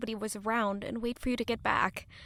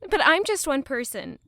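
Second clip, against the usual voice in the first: building to a loud, higher, upset outburst; rising distress; 4 semitones higher, 8 dB louder than usual. Acted. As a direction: panic rising until she's cut off. Growing distress, cut off.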